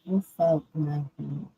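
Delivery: ordinary talk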